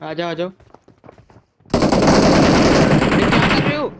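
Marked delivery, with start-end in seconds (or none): 1.7-3.9 exasperated cry. Real, not acted. Exasperated at teammate.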